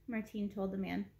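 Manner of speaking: plain reading voice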